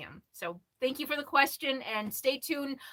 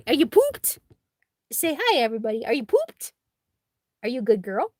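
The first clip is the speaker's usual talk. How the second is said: talking to the dog; 7 dB louder than usual. Real, not acted. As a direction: coo at your dog for the camera. Cooing at tired dog.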